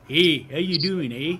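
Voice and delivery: lightly put-on Newfoundland accent; Newfie accent. Canadian showing how everybody assumes they talk, mock Newfie accent. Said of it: "everybody talks like a Newfie".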